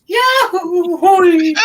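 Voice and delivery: very high, loud "yahoo" whoop; whooping yell. Pretend. Game master voices a knocked-down flyer, whooping yell. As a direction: yelp a drawn-out cartoonish holler.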